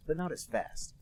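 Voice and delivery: ordinary delivery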